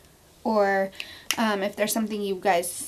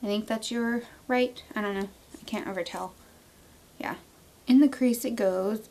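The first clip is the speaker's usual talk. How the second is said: ordinary talk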